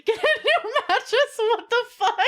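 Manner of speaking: high, loud laughing disbelief; incredulous laughing. Real, not acted. Reacting to chat advice.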